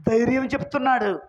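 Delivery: usual speaking voice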